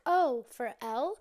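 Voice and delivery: loud, drawn-out "oh" rising to a question; slow, loud, questioning; mild recognition. Retold or imitated. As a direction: clerk suddenly placing the name. Attendant recognising a ticket name.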